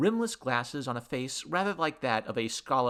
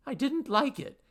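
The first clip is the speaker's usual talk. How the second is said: high-pitched nervous babble; rattled and high; babbling; 13 semitones higher than usual. A narrator's reading. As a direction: babble it out anxiously, voice raised high. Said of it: "babbled Henry".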